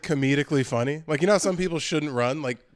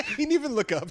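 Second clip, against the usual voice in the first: laughing; 12 semitones higher, faster than usual. Real, not acted. Cracking up at friend's answer.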